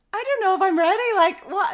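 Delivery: husband fearing twins, high and panicky; panicked husband. Retold or imitated. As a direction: squeak out his panic. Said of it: "And he was like".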